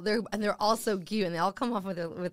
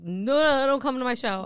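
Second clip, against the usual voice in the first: louder, higher pleading refusal; mock protest; 4 semitones higher, 5 dB louder than usual. Telling dates to stay away from the show, mock protest. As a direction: ward them off in mock alarm.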